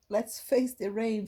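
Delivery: ordinary delivery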